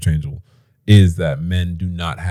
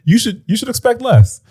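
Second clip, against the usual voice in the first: mock panicked man; 9 semitones higher than usual. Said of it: "It's like".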